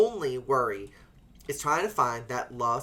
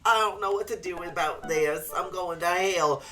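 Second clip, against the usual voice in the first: high, wavering distraught voice; mock sobbing confession; imitating a crying man; 5 semitones higher than usual. A guy confessing to a friend, mock crying. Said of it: "crying and being like".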